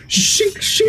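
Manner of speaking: high, sharp claw-popping noise; imitated claw sound. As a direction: sound out blades sliding out. Voicing claws popping out.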